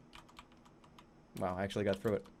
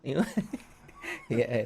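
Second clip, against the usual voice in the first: ordinary talk, nothing marked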